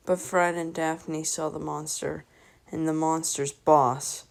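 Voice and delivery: ordinary delivery, nothing marked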